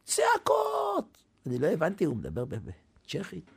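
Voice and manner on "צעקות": imitated shouting. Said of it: "צועק, צועק על השומר".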